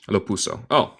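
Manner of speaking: ordinary delivery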